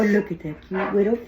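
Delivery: ordinary delivery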